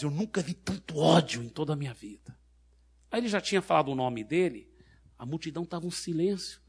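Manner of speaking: ordinary delivery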